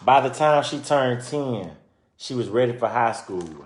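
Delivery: lively storybook narration; reading to children. Acted. Reading a children's book aloud to listeners, lively storytelling. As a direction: read it out like a picture book for kids.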